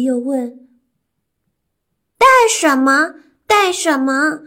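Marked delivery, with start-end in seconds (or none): 2.2-4.4 high, loud calling out; to someone hard of hearing. Acted. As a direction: shout so granny can hear. Fox keeps shouting to deaf granny.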